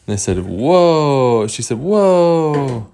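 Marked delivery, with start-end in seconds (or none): 0.5-1.5 amazed. Acted. Visitors react with amazement. 1.8-2.9 long, higher whoa, falling away; acted amazement. Cage girl invited to live with them, amazed.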